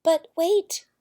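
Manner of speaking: high, loud call to stop; the prince cuts in to stop his mother; loud, pleading but wait; urgent interruption. A narrator's reading. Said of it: "said the prince".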